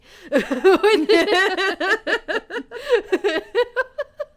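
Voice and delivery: high burst of real laughter; loud laugh. Real, not acted. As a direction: let a big laugh burst out. Laughing at own prank.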